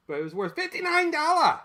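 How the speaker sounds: high, loud indignant exclaim; hammed-up disbelief; mock outrage. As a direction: cry out at the price in mock shock. Mock outrage at old price.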